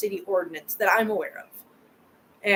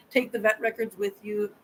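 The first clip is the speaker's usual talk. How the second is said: normal talking voice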